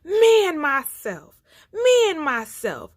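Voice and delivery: loud, high mock roar; hammed-up lion bellowing his boast; storyteller's roaring lion. A narrator's reading. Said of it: "and then he roared".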